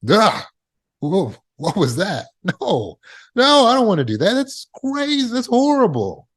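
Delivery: acted horror, voice climbing high as it rejects the idea; appalled repudiation. Shown as a demonstration. Speaker showing how to repudiate a wild thought, appalled. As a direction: recoil with a grunt, then high-pitched disgusted protest. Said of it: "You should immediately repudiate it".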